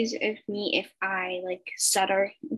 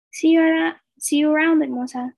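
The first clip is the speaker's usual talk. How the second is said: louder, higher call while leaving; yelled goodbye; 6 semitones higher, 6 dB louder than usual. A narrator's reading. Boy calling to the girl while walking away, yelled goodbye. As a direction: call it back over the shoulder. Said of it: "he yelled and winked".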